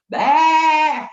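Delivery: high, loud billy goat "Bah"; loud playful goat bleat at the start of the game. Retold or imitated. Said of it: "and he would go".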